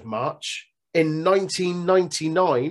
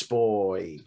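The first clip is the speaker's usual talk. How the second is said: ordinary talk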